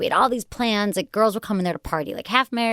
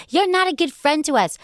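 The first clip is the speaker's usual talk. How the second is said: higher-pitched mock Jersey Shore girl; Jersey Shore accent; 6 semitones higher than usual. Jersey girl scolds her friend, mock Jersey Shore accent. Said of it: "Do a Jersey Shore".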